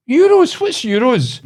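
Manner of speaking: high, loud baffled old-lady impression; mock bewildered old lady; wee old woman. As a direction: imitate an astonished old woman, pitched right up. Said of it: "this wee old woman".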